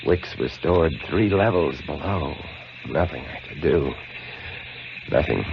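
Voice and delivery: low, soft and defeated, trailing off; hopeless murmur. Acted. Keeper in the dark lighthouse, hushed and defeated. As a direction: sink into quiet despair.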